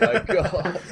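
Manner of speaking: high, laughing oh my God; mock dismay. Retelling his cringe at the game, high and laughing. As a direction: laugh through a cringing gasp. His own retold reaction.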